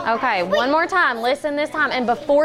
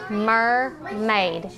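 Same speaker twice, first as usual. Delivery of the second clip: "Mermaid" split into mer, maid; teacher's drawn-out two-beat "Mermaid"; syllable by syllable. Shown as a demonstration. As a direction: separate both syllables of "Mermaid" clearly. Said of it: "The first syllable or the second syllable?".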